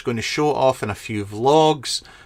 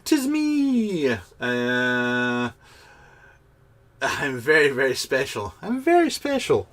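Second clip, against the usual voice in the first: put-on comment voice; slower than usual. Reads viewer comment in voice.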